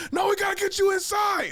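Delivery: high, loud panicked shouting; panicked yelling. Retold or imitated. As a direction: yell it like you need to flee now. Homie insists again.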